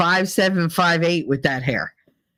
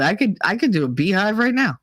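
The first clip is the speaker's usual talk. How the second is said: normal talking voice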